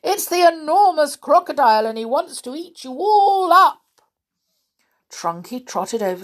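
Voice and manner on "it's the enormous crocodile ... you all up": loud, fast, high alarm call; bellowing. Acted. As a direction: bellow the warning urgently. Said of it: "he bellowed".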